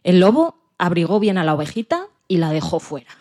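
plain reading voice

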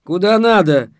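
loud, high, brusque reply; rude retort. A narrator's reading. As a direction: shut the question down rudely. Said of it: "грубо ответила Наталья Сайдулаевна".

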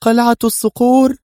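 high, loud cry of dread; aghast exclamation; horrified. A narrator's reading. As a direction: echo it in alarm, pitched far up. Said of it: "وهي تردد في ارتياع".

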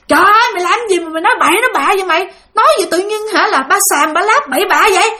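mock scolding. Friends scolding the girl for crazy talk, fast teasing scold. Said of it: "mấy đứa kia nó chửi nó".

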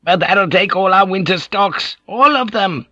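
high-pitched complaining whine; worried fisherman whining about lost winter stocks; infuriating whine. A narrator's reading. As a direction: whine it out like a nagging villager. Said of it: "Ninjin's voice was an infuriating whine".

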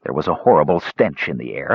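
plain reading voice

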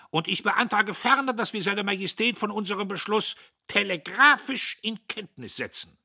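high, loud formal motion; pompous orator at full voice; blustering patriot, proclaiming. A narrator's reading. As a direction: proclaim it to the whole table. Diederich proposes a motion.

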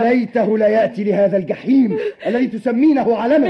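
high, loud bitter shouting; furious. Acted. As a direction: yell in a full rage at your wife. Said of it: "من فضلك اهدأ". (بغضب عارم وصراخ)

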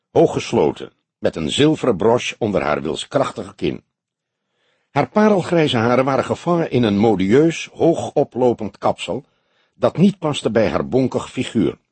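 usual speaking voice

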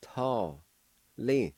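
"Day, yos, di" as three separate syllables; slow syllable drill. Shown as a demonstration. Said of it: "First part is pronounced like this".

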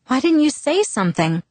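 high, scolding reproach. Acted. Bella to sick Mike at the exit, scolding. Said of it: "I scolded".

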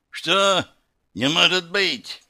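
high, startled exclamation; incredulous outburst; stunned disbelief. A narrator's reading. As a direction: exclaim in shock, voice jumping up. Demon stunned as blow is blocked.

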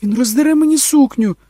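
very high, loud shriek; panicked scream. A narrator's reading. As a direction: shriek for help in the struggle. Mother in struggle with frantic child. (панічний вереск)

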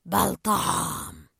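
hungry shark. Acted. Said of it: "واندفع مجددا وهو يصيح".

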